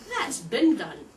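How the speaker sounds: loud American "been" said as "bin"; mock American. Shown as a demonstration. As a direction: throw out "That's been done" with an American "bin". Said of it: "did B E E N become bin".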